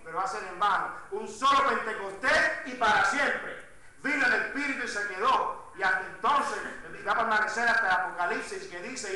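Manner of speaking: loud, driving sermon delivery, words stretched for weight; full-force preaching. Acted. Pastor urging his congregation, loud full-force preaching. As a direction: press the congregation hard from the pulpit. Preacher proclaiming the Spirit stays.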